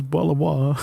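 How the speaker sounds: jokey British, t's swallowed; mock British bottle of water; British accent. Shown as a demonstration. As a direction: put on a glottal-stop British accent. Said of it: "I can do a British accent".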